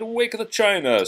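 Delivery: high, loud, lively; rally the kitchen; cheery order. Acted. Mrs Potts rallying the dishes for Belle. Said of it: "Missus Potts said".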